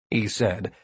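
ordinary delivery

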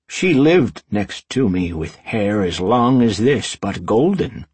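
slow, projected wizard storyteller; reminiscing wizard. A narrator's reading. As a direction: recall it aloud as the old master. Voices wizard recalling a girl.

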